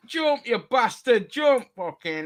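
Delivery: high, drawn-out hollering; mock wrestling yell. Fan urges wrestler to jump, mock yelling. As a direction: egg him on from the crowd. Plays crowd at wrestling match.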